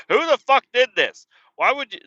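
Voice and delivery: loud, high, angry demand; angry rant. Retold or imitated. Driver venting at careless coworkers, loud high angry rant. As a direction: yell it up high at whoever did it.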